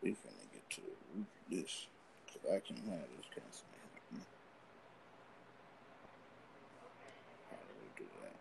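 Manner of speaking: audio cutting out, fading away; tape dropouts. Real, not acted. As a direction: signal dropping to almost nothing. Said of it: "Why is the sound cutting".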